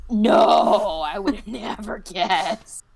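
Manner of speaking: loud, drawn-out mock amazement on "no"; sarcastic. Real, not acted. Friend answering an obvious explanation of the disassembler, sarcastic. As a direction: lay the sarcasm on thick and loud.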